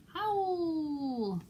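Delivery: put-on playful wolf howl for kids. Librarian imitates wolf.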